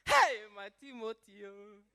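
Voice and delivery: hammed-up joyful cry of a proud mother; celebrating Nigerian mother. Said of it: "you know Nigerian women".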